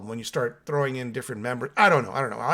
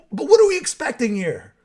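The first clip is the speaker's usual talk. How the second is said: louder and higher, pressing the point; emphatic rhetorical question; 9 semitones higher, 8 dB louder than usual. Real, not acted. Podcaster pushing back at fan comments, loud and higher. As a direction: demand it of the listeners, raised. Defending the new song.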